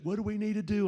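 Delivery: high-pitched shout over the rattling plane; yelling over the noise. Retold or imitated. Pilot yelling over the nosedive noise, high shout. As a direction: call out over the diving engine. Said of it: "he's yelling over it".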